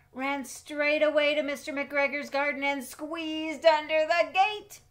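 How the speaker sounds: thrilled read-aloud gasp of suspense; excited storytelling. A narrator's reading. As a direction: build it up breathlessly as Peter runs off. Dramatic narration of naughty act.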